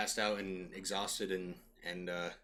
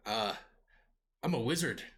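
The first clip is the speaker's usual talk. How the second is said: put-on lightly Scottish-tinged dwarf accent; dwarven accent; 3 semitones higher than usual. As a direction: pipe up with a hint of a dwarven accent. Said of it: "like, dwarven accent".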